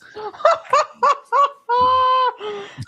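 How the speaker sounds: squealing burst of laughter; high shrieking laugh. Real, not acted. Big laugh.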